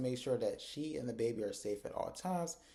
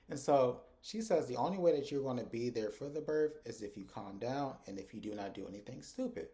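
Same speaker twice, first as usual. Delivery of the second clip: ordinary talk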